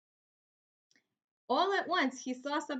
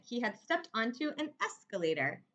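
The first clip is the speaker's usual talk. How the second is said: usual speaking voice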